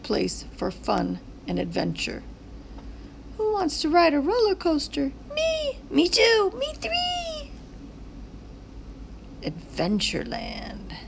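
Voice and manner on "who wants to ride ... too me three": high, eager calls of me; high excited shouts of me; excited character voices. Acted. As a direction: kids clamoring to ride. Signs shout for ride.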